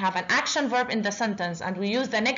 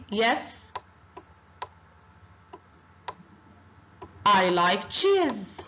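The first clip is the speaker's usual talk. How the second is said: model answer; much slower than usual. Shown as a demonstration. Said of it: "I say".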